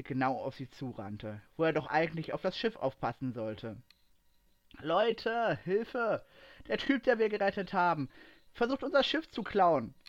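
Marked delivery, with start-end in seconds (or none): 4.8-9.9 high-pitched cry for help; desperate call. A narrator's reading. Aslan runs to his crew about the stolen ship, desperate. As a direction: call out in alarm, pitched well up. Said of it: "rief er verzweifelt aus".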